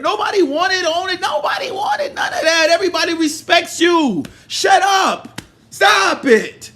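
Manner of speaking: high, loud, furious yelling; furious shouting. Real, not acted. Telling Joe to shut up, furious high shout. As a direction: shout him down in real anger.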